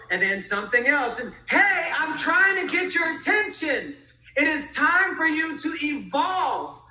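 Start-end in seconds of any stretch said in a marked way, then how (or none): 1.5-6.8 higher, insistent call for attention; stronger nudge. Acted. Life nudging someone stuck in the same place, insistent. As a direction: raise the pitch and demand to be heard. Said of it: "get a little bit stronger".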